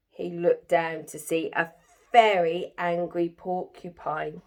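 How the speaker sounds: usual speaking voice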